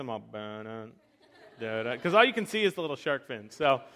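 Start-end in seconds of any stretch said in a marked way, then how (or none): 0.2-2.0 low hummed Jaws theme. Retold or imitated. Dad humming the Jaws music for his son. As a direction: hum the shark music low and ominous. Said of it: "that Jaws noise".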